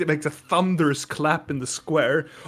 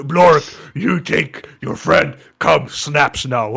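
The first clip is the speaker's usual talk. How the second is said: slow, louder broken speech; Minotaur voice; 6 dB louder, slower than usual. Acted. Minotaur calling his friend to drink, slow brute voice. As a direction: order it like a big brute. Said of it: "he has like".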